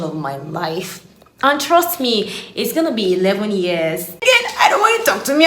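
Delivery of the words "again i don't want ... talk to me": angry retort. Retold or imitated. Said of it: "I was so mad at God".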